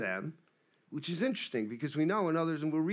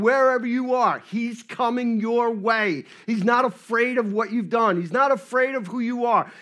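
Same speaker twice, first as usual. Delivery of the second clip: higher, louder, insistent preaching; full-voiced sermon build; forceful preaching; 6 semitones higher, 7 dB louder than usual. Real, not acted. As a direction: drive each reassurance home to the congregation.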